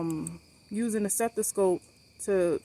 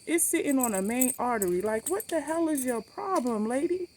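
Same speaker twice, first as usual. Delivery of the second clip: retold indignant scolding; 7 semitones higher than usual.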